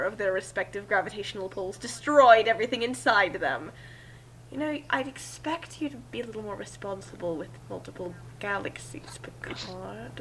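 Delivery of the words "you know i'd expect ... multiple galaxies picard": softer and softer, down to a whisper on the name; gently scold, fading to a hush; a quiet scolding trailing into a whisper; soft chiding. Acted. Q scolds the Fourth Doctor.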